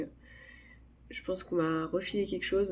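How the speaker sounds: normal talking voice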